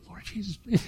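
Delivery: a nervous murmured prayer; hushed prayer. Retold or imitated. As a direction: pray quietly in a tense moment. Praying while holding the ladder.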